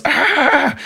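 high sharp mock cry of pain; injured friend's pain cry, high mock yelp; imitated scream. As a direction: yelp high as if glass is pulled out. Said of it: "And she goes".

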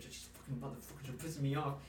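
soft, grumbling mutter; talking under her breath. Retold or imitated. Imitating the pissed coworker back at the freezer, grumbled under her breath. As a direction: imitate someone fuming quietly to herself. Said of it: "talking under her breath".

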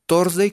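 "Thursday" as "tors-day", plain t; like Thor's day. Shown as a demonstration. Teacher starting simple, "Thursday" as "tors-day". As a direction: start "Thursday" simply, like the name Thor in Spanish. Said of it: "como si habláramos del día de Thor".

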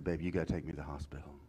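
low, quick, hushed urging to his wife; husband urging his wife, low and hurried; urgent low plea. Retold or imitated. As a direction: press her softly but fast. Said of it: "I said".

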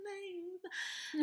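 very high, soft little greeting; awkward fake-friendly. Gym worker answering a weird member, high and soft. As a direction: squeak it out softly, mock-sweet.

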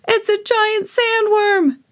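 very high, slow terrified cry; shrieking. A narrator's reading. Another beachgoer panicking, shrieking. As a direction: shriek it in horror. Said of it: "shrieked another".

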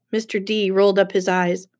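ordinary delivery, nothing marked

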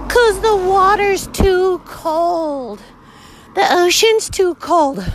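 high, dragged-out mocking whine; hammed-up whining excuse, very slow; mock whiny hiker. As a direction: drag it out high, like a pathetic complainer. Mocking hikers who won't swim.

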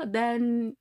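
normal talking voice

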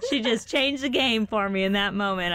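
high, loud words breaking into laughter; real laughing. Real, not acted. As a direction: crack up mid-sentence and push on through it. Breaks up laughing while retelling.

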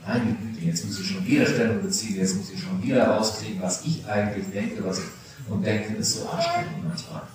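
put-on muttered, quick, put-upon teen sigh; weary grumble. Pastor voicing tired confirmands, mock weary grumble. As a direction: grumble it like a fed-up teenager. Teens grumbling about taking positions.

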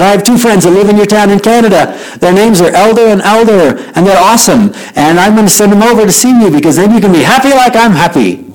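high, bubbly retold offer; eager happy girl. Girl offers to send friends to couple, eager and happy. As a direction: pitch it up with eager delight.

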